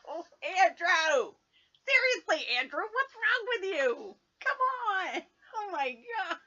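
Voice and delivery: high, loud exasperated cry at the screen; loud high "what's wrong with you". Real, not acted. Exasperated at TV couple.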